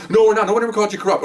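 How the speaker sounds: captain insisting nobody called them corrupt, defensive. Retold or imitated. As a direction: deny it, a bit flustered. Captain's quoted denial.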